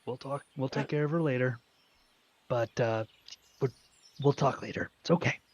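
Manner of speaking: soft, hushed comforting words; calm him down gently, arm round his shoulder; gentle reassurance. Acted. Thomas gently soothing Scree with an arm around him, soft and hushed. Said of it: "very gently put his arm around Scree".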